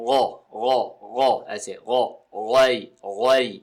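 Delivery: غو with a thick throaty غ; heavy ghain. Shown as a demonstration. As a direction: slowly voice غوی deep in the throat. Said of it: "غین کی آواز ہمیشہ پر رہے گی".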